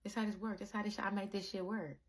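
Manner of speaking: soft, quiet voice of God explaining; hushed knowing divine voice; calm God voice. Retold or imitated. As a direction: gently show them how it works. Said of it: "And God shows you".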